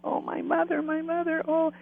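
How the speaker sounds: high, plaintive wail without real sobs; imitated tearful cry. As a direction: mimic a man crying out for his mother. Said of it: "he just sobs and cries".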